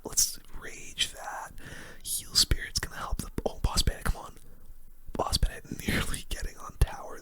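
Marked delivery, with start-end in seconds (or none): none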